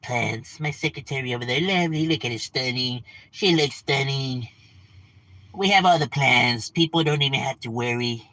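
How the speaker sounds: a hint of Trump bragging about his plans; Trump impression. Mocking Trump bragging to the audience, lightly voiced. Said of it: "That's a good Trump impression".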